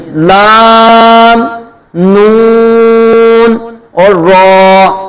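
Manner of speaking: slow, sustained لام, نون and را; drawn-out recitation letters. Shown as a demonstration. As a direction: stretch each letter like in recitation. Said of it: "یہ حروف طرفیہ ہیں تین".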